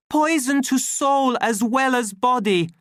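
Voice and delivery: very high, loud cry; impassioned denunciation. A narrator's reading. Savage cutting off the official, impassioned cry. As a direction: override the other man with fervour. Savage cuts in, denouncing soma.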